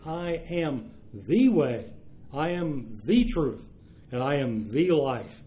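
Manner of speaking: slow, heavy stress on "the" each time in "the way", "the truth", "the life"; very emphatic. Retold or imitated. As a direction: punch every "the" before "way", "truth" and "life", drawing the phrase out. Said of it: "Very emphatic. He says".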